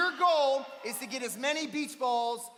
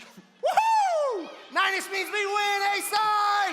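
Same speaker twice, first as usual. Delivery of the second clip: excited cheer; 4 semitones higher, much slower than usual. Real, not acted. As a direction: cheer like you just won. Celebrating the game result.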